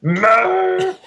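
butcher the movie scream on purpose; awful mock scream. Friend mocking the Interstellar scene, shrill hammed-up scream. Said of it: "my idiot friend going".

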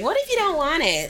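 high-pitched mock of a parent; complaining parent. Mocking a parent who objects to free lunch money, high-pitched whine. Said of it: "I know some parents was like".

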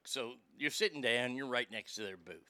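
usual speaking voice

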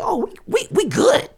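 very high-pitched celebratory squeal; gleeful mock gloating. Imagining the rapper checking his streaming numbers, gleeful mock gloating. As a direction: squeak it out like a giddy winner.

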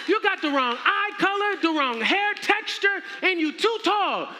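very high, theatrical tirade; mock-outraged shouting. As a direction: holler for guards to throw out an impostor. Acted royal calls guards on him.

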